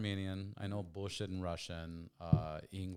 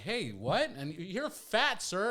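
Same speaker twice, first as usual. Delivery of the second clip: loud, raised-pitch retold exchange; mock confrontation; 10 semitones higher, 8 dB louder, much slower than usual. Retelling son's clash with a stranger, loud mock confrontation. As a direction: play the quarrel big for laughs. Acted quarrel with offended stranger.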